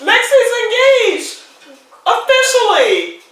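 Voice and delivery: high, loud, drawn-out joyful shout; elated announcement. Real, not acted. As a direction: shout the big news slowly, stretching each word. Calling out the engagement news.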